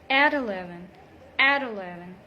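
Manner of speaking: at eleven linked as "a deleven"; linked flap T. Shown as a demonstration. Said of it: "we link the words together".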